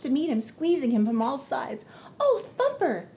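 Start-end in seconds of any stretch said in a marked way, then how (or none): 2.2-3.1 much higher, loud relieved cry; excited exclamation. Acted. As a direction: exclaim in delight. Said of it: "exclaimed Daisy".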